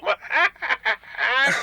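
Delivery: a short, high delighted cackle; gleeful cackle. Acted. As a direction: laugh at the memory of scaring them. Herb gloating about spooked mutants.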